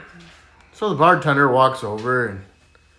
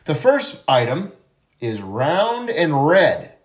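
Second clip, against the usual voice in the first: slow teacher riddle voice; much slower than usual. Acted.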